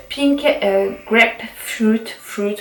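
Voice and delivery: English product name in a French accent; slow, careful English with a French accent. Real, not acted. Vlogger reading a shower gel name, French-accented English. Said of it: "mon accent anglais est toujours plus".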